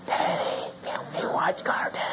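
put-on tiny hushed little-kid whisper; whispering child. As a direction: whisper like a child waking a parent early. Voices his child at six o'clock.

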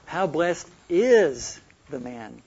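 slow reading with a big emphatic IS; stress on IS. Shown as a demonstration. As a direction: punch and hold the word IS. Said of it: "Emphasizing one word after another".

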